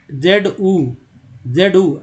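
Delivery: जेड ऊ as separate "zed", then "oo"; wrong spelled-out reading. Shown as a demonstration. Teacher shows what learners should not say, spelled apart. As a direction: split जेड ऊ into letter name and vowel. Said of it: "ऐसे नहीं पढ़ते हैं".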